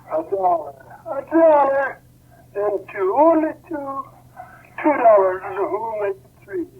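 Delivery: lightly voiced auctioneer, pitched high; a hint of an auction-room call; auctioneer calling bids. Auctioneer opens low bidding on violin.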